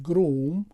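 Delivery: گروم with the oo held long; English groom. Shown as a demonstration. Teacher modelling groom for students.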